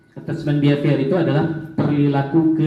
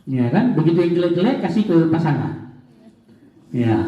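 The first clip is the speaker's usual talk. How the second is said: usual speaking voice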